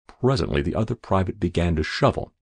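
ordinary delivery

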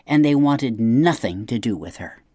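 usual speaking voice

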